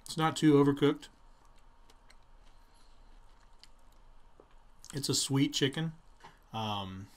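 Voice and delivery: talking between bites; chewing while talking. Real, not acted. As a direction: mouth partly full. Seen 0: Bites chicken at -7, mouth working; another bite at 7.4.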